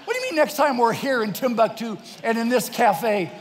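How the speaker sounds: loud, high, incredulous comeback; exasperated retort. Retold or imitated. Husband to wife about eating in Timbuktu, exasperated.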